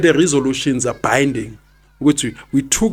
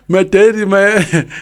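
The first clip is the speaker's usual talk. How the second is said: laughing; 5 semitones higher, 3 dB louder, slower than usual. Real, not acted. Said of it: "I shocked my baby".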